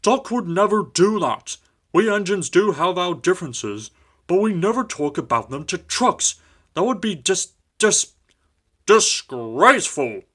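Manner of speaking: proud engine defending Duck, indignant; pompous big engine. A narrator's reading. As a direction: sound grandly offended. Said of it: "said Gorn".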